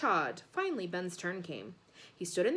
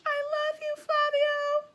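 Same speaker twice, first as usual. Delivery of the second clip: very high, loud woman's screech; a mum screeching her love at the host; screeching fan; 6 dB louder, slower than usual. A narrator's reading. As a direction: squeal it like a besotted fan. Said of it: "one woman's voice screech".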